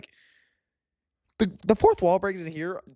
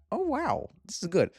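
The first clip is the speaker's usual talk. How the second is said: amazed reaction; 9 semitones higher than usual. Retold or imitated. Reacting to the final fight.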